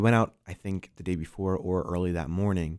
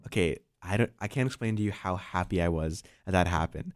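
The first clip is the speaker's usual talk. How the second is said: ordinary delivery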